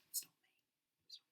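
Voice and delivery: soft whispered denial; teacher joking that she isn't the witch; whispered aside. Real, not acted. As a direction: whisper it to the class with a wink.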